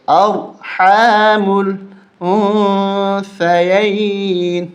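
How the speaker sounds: slow drawn tajweed chant; slow recitation. Shown as a demonstration. Teacher recites the verse slowly for students to follow. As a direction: draw each syllable out in recitation. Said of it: "رواں پڑھیں گے".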